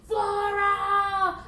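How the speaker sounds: high, loud drawn-out holler; furious boss yelling for staff; bellowed summons. Acted. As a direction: bellow the assistant's name impatiently.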